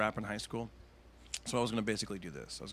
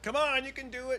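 much higher, coaxing cheer; encouraging crowd; 13 semitones higher than usual. Retold or imitated. Audience coaxes the nervous-seeming comic, high. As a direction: urge on a nervous act. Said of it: "people would be like".

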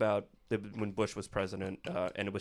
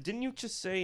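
much higher, puzzled; confused question; 10 semitones higher, 4 dB quieter than usual. Retold or imitated. Comic asks his date what she said, high and puzzled. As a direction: ask it like you can't believe you misheard.

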